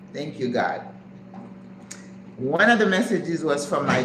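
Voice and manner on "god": normal talking voice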